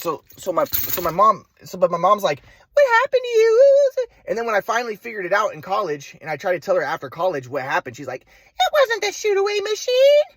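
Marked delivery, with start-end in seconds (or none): 2.7-4.1 high, shrill-pitched mom imitation, a bit louder; mocking mom voice. Son mimics his mom asking what happened, high mocking voice. 8.2-10.3 much higher, slow whiny mom impression; mocking mom voice. Son imitates mom blaming pressure, slow high mocking voice.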